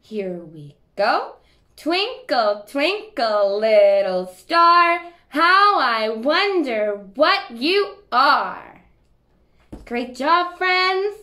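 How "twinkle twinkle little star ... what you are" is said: slow loud called-out rhyme; shouting. Shown as a demonstration. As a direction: shout it out, slowly. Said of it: "Let's try it together".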